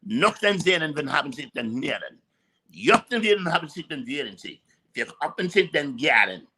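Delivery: mock German gibberish, rolling and guttural; fake German. Shown as a demonstration. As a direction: rattle off nonsense German with confidence. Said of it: "I can speak fake German very well".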